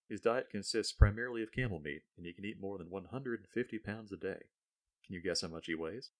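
ordinary delivery

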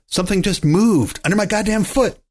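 high, alarmed outburst; loud and shaky. A narrator's reading. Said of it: "his voice still loud and shaky".

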